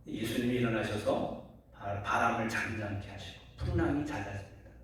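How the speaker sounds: ordinary delivery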